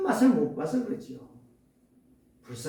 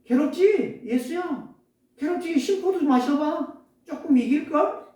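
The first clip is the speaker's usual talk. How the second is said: higher-pitched sneering jeer; mocking taunt; 9 semitones higher than usual. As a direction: taunt him like a mocking soldier. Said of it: "군인들도 희롱하면서".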